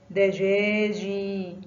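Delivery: دجاجي slowly, final جي held long; teacher stretching the long ي in دجاجي; drawn-out example word. Shown as a demonstration. Teacher showing pupils the long vowel, slow and stretched. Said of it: "ينطق جي".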